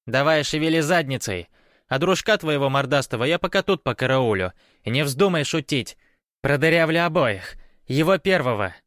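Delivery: high, loud, threatening woman; demanding woman. A narrator's reading. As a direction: boss and threaten them as the armed intruder. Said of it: "потребовал женский голос". (грозный, требовательный женский голос)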